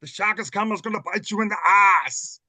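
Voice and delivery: slow, loud Austrian Arnold bark; Schwarzenegger impression. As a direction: shout it like Arnold. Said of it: "Comes Arnold Schwarzenegger around".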